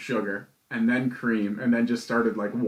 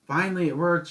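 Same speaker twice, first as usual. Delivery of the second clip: higher-pitched imitation of a rambling woman; woman guest retold; 5 semitones higher than usual. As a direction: retell her complaint in a raised voice. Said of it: "some woman comes down and she's like".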